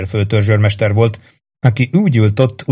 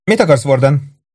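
a touch of irritation, higher voice; slightly irritated; 4 semitones higher than usual. A narrator's reading. The barmaid reluctantly answers Warden, slightly irritated. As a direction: answer the summons testily. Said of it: "Azért kissé ingerült volt".

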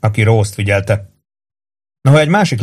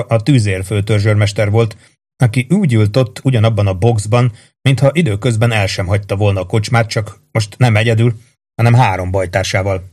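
usual speaking voice

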